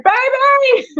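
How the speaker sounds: high-pitched call to someone in another room. Retold or imitated. Wife calling her husband from the couch, high urgent call.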